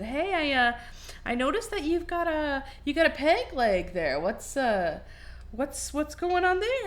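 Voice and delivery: high, friendly bartender; lightly voiced bartender. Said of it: "the bartender said".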